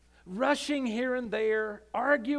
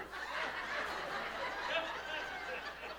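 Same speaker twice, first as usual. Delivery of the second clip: soft breathy laugh; 6 semitones higher, 12 dB quieter than usual. Real, not acted. Laughter after punchline.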